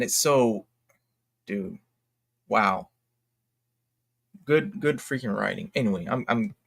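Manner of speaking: usual speaking voice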